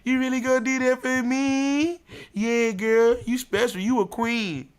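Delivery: high, squealing delighted woman; excited woman. Retold or imitated. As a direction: gush like she's just been promised everything.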